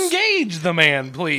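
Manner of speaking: put-on loud, high slurred drunk voice; mock the confused drunk stranger; imitating drunk man. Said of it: "And he goes".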